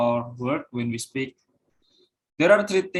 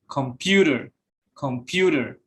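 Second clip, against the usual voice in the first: "computer" stressed on PU, falling after; middle-syllable stress. Shown as a demonstration. Student presenter showing stress in mid-word, "computer" stressed on PU. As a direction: lift and lean on the PU of "computer". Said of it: "In the middle".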